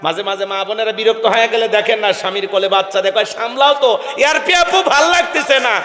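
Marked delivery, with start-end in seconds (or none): 3.3-5.7 exasperated mother, higher and shouting; a fed-up wife shoving the baby at her husband; annoyed mother. Retold or imitated. Fed-up mother shouts at husband to take the baby.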